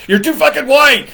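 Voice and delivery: high, loud mock teacher verdict with a laugh; imitating the professor. Professor scolding the student's pronunciation, high, loud and laughing. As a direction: bark it high like an exasperated teacher. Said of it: "She goes".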